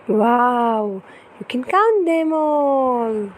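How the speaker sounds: put-on lively, sing-song storybook reading for a toddler; reading to children. Parent reading an ocean picture book to baby, animated storytelling. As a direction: read it brightly to hold a little one's attention.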